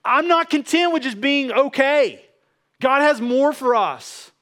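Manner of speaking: loud, high fervent preaching; passionate preaching. Real, not acted. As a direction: preach it at full force. Passionate preaching at full force.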